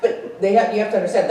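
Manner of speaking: usual speaking voice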